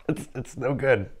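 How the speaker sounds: muffled through fingers; laughing with food in mouth; hand over mouth. Real, not acted. Seen 0: Hand covers mouth while laughing; snail eaten earlier, chewing unclear.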